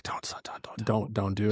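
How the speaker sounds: hushed whispered pleading; wife whispering a warning to him; whispered plea from a wife holding his hand; urgent whisper. Retold or imitated. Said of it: "she's gripping my hand tighter".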